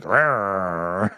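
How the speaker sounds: low, lightly voiced growl; mock growl. Retelling the dog barking at the TV with a low mock growl. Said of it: "a video where he's like".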